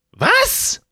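put-on high, loud old crone voice; shrill old woman. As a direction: squawk it like a cranky old woman.

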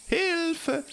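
high, desperate call for help; cry for help. Acted. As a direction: shriek it in panic. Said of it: "rief Anansi".

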